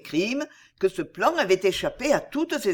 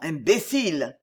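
ordinary delivery, nothing marked

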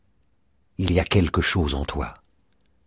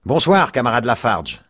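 higher, hearty evening call; hailing; 6 semitones higher, 4 dB louder than usual. A narrator's reading. Boatman greets his comrade on arrival, hailing him. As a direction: hail a friend from the water.

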